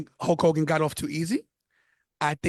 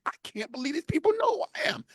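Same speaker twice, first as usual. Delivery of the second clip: much higher, disbelieving; amazed; 10 semitones higher, 3 dB quieter than usual. Retold or imitated. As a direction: gush it in disbelief. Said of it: "I'm like".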